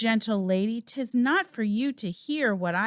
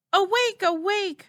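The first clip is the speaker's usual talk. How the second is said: alarm cry; 8 semitones higher than usual. Acted. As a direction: shout them awake, the king is dead. Raising alarm over king's murder.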